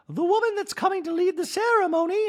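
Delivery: very high put-on voice; hammed-up squeaky announcement; Dorit. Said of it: "Dorit goes".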